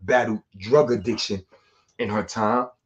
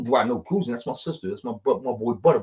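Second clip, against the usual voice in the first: ordinary talk, nothing marked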